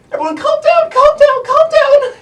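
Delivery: put-on very high, loud, panicky little girl voice; a tiny gnome trying to calm everyone; squeaky gnome girl. Gnome girl pleads with the group.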